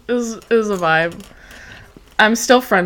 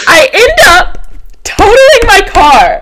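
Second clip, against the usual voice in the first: loud, high, drawn-out confession; mortified, stretched-out near-shout; dramatic reveal; 11 semitones higher, 7 dB louder, slower than usual. Real, not acted. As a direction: wail it out slowly, word by word. Dramatic reveal of the car crash.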